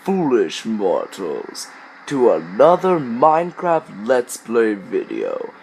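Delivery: low, slow ghostly host; spooky host voice. Pretend. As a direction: welcome guests like a haunted house ghost. Spooky Haunted Mansion host intro.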